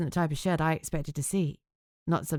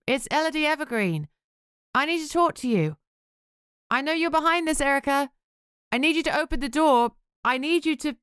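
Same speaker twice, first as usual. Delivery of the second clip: high, raised, insistent demands; urgent calling; 10 semitones higher, 3 dB louder than usual. Acted. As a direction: press her to come out, voice up. Confronting Erica outside the shed.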